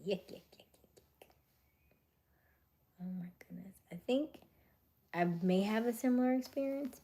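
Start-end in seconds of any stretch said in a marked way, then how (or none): none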